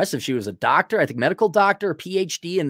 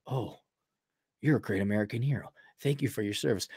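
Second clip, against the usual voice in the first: mock polite; 5 semitones lower, 11 dB quieter, faster than usual. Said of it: "the Les Grossman quote from Tropic Thunder".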